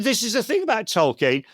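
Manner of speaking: ordinary talk, nothing marked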